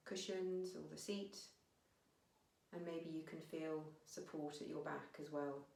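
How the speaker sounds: unhurried meditation guiding with long pauses; guided meditation. Real, not acted. Teacher guiding listeners to notice their breath, calm meditation voice. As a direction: ease them gently inwards, phrase by phrase, leaving space.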